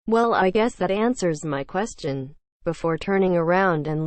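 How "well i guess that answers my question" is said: ordinary delivery, nothing marked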